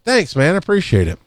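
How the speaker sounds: upbeat coworker thanks; grateful thanks. Shown as a demonstration. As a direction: thank him lightly and sincerely. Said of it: "I'm still gonna say".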